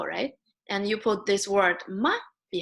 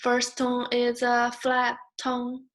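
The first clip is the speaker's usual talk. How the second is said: "First tone is a flat tone" slow on one high level pitch; tone song, flat; 4 semitones higher than usual. Shown as a demonstration. Teacher recalling the tone song, flat high chant. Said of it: "you still remember the song".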